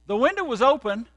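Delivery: normal talking voice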